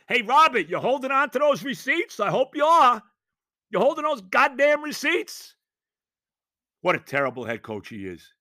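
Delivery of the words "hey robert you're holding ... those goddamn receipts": loud, higher angry jab at Robert; angry callout. Real, not acted. Fan calling out Robert over receipts, heated and loud. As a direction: call him out, heated.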